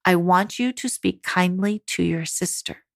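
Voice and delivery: low, measured, calm instruction; even voice tone. Shown as a demonstration. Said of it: "Have even body posture and voice tone and say".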